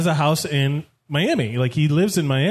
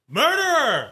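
retold shout; 8 semitones higher, slower than usual. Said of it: "he goes".

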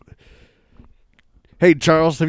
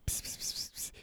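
cat-calling pss; 22 dB quieter than usual. Acted.

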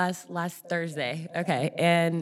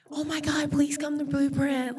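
excited plea; 7 semitones higher than usual. Retold or imitated. Friend invites her to church.

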